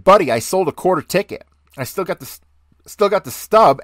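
re-enacting an indignant protest to an officer; retold protest. Retelling his protest to the officer.

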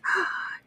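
soft breathy gasp of surprise; mock startled gasp; dramatic gasp. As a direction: gasp as if spotting the octopus. Octopus emerges from darkness.

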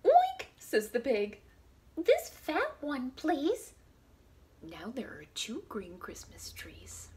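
lively storybook read-aloud; reading to children. Acted. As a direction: read it out to little kids. Reads picture book to children.

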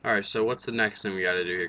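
usual speaking voice

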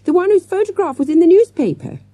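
high excited alarm; shouted. A narrator's reading. Girl pointing out the man to classmates, shouted. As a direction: cry out the identification in a high voice. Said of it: "she shouted".

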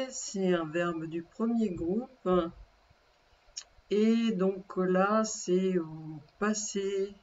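normal talking voice

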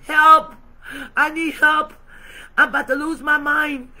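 loud pleading cry, help shouted; shouted plea, then pressing on loudly; desperate cry for help. Acted. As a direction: cry out to God at the end of your rope. Lois crying out to God.

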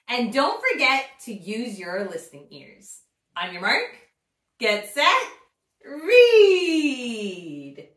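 put-on bright, cheerful intro to kids; upbeat children's host; story time host. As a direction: invite the little ones to read along.

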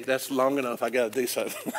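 ordinary talk

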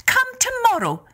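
high, lilting Irish wife; Una's voice. Acted. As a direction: sweetly put off the visitor in a high voice. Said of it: "she said".

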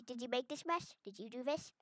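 usual speaking voice

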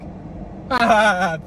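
short forced mock laugh, high; fake laugh. Imitating a stranger's fake laugh for viewers. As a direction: put on a hollow fake chuckle. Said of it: "fake ass laugh".

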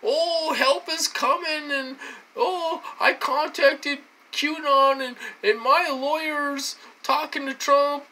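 high, taunting imitation of the caller; mocking impression. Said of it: "She comes on these calls".

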